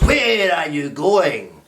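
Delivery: slow, sly wolf stopping her on the path; storybook wolf greeting a girl. Acted. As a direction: draw out the words like a sneaky wolf. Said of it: "the wolf stepped out and goes".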